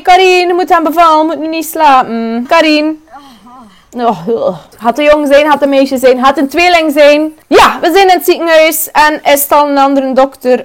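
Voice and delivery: usual speaking voice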